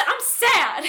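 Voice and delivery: high, lightly hammed-up wail; comic whine on the edge of laughter; mock sad outburst. Flustered heroine to her suitor, mock-sad outburst.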